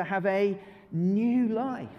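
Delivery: slow, drawn-out chant; new life said slow together; slow group recital. Shown as a demonstration. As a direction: lead the congregation slowly. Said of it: "can we say it together".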